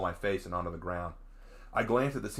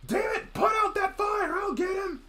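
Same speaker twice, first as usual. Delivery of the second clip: very high, loud urgent orders; panicked battle shouts; frantic shouting; 6 dB louder, slower than usual. Acted. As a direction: yell commands over the chaos. Chaos, shouting orders in attack.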